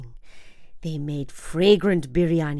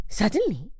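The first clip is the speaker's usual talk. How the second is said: usual speaking voice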